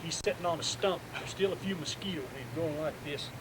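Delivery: ordinary talk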